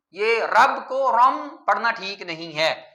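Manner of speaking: usual speaking voice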